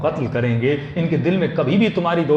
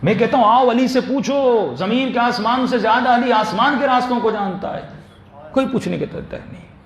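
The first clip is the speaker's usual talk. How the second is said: impassioned preaching; 7 semitones higher than usual. Acted. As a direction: plead with the crowd from the pulpit. Preaching at full force.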